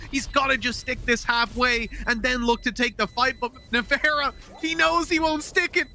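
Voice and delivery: fast, high, excited esports commentary; hyped play-by-play. Real, not acted. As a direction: call the clutch at full energy.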